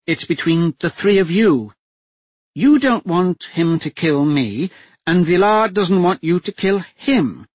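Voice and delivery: a hostage duchess coolly taking charge; steady duchess voice. A narrator's reading. Captive duchess telling the men to make a deal, steady and firm.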